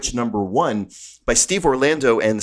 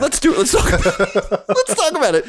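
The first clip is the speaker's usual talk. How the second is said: much higher, laughing proclamation; mock witch voice; 6 semitones higher than usual. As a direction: cackle it out gleefully.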